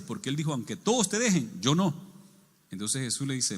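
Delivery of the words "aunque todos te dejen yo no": higher, bold boast; cocky confidence. Retold or imitated. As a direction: declare it with self-assured force. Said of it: "La otra vez bien campante".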